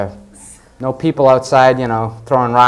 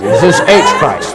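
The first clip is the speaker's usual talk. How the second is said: mock swearing. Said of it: "include the middle initial".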